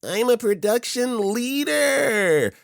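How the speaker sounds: high, slow, whiny nasal voice; pinched nasal whine, drawn out; nasally voice. Retold or imitated. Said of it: "the most nasally voice ever saying".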